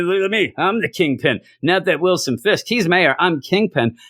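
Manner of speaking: imitating an affronted would-be crime boss; indignant mock freak-out. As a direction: insist indignantly, hammed-up. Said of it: "this is where Owlsley freaks out".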